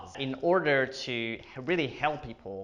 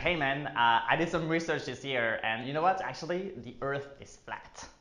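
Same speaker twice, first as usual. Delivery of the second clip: ordinary talk, nothing marked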